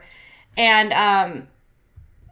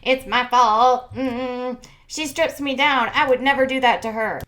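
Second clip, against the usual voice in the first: louder, sulky mock "it's my fault"; mock defensive whine, louder; sarcastic self-pity; 4 semitones higher, 5 dB louder than usual. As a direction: ham up a sulking boyfriend. Mocking the boyfriend, continued.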